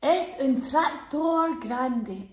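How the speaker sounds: slow, drawn-out tractor grande; slow dramatic. Acted. Host reveals the big tractor to kids, slow and grand. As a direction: stretch it out to make it sound big.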